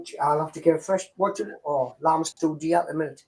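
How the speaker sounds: ordinary talk, nothing marked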